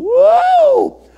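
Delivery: high, loud "Whoa"; excited cry, high and loud. Real, not acted. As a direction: let it ring out. Excited exclamation.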